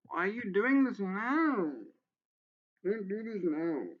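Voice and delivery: fed-up rant at the author; exasperated outburst. Real, not acted. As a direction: pitch climbs on "doing", then falls. Ranting at the guide's step order.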